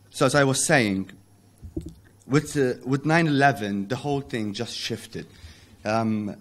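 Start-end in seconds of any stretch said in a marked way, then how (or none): none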